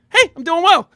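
very high, peppy reply; energy-matching greeting. Acted. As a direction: bounce the cheery hello right back. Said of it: "I match their energy".

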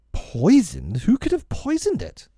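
put-on swooping up to a high boyish pitch; incredulous question. A narrator's reading. Peter doubting the fairy's warning, incredulous high voice. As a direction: rise on the first word, then question up high.

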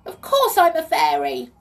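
high, loud, indignant little fairy; tiny high voice. A narrator's reading. Fairy answers her captors, tiny high voice. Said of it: "said a tiny high voice".

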